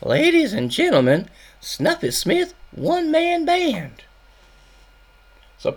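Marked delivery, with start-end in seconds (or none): 0.0-4.0 put-on a little boy announcing a grand act; kid announcer voice. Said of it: "and he says".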